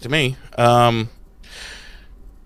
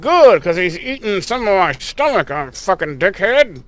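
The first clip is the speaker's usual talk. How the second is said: Willow voiced; 4 semitones higher than usual. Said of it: "Willow's like".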